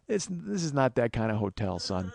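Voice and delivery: lightly voiced father, low and quiet; disappointed dad. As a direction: gently reprove him like a son, voice sinking. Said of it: "dad-son type of response".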